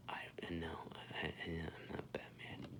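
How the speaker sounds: whisper. Real, not acted. Sheepish whispered aside.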